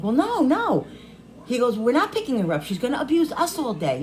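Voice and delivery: high, firm mock of the husband; a hint of the husband's flat refusal; husband refusing. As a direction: voice the husband saying no.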